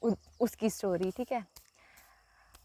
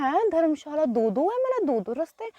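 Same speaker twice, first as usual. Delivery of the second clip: retold confusion; 6 semitones higher than usual.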